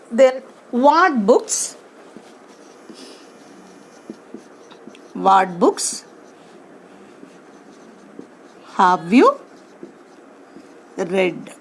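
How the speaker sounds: slow, spaced out correct question; question read word by word. Shown as a demonstration. Teacher dictates the correct question to students, word by word. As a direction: dictate each word with pauses. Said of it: "this is wrong".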